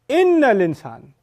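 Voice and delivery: "Inna" punched high and loud; emphatic inna. Shown as a demonstration. Teacher showing the particle removes doubt, "Inna" high and loud. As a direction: lean hard on "Inna", then fall.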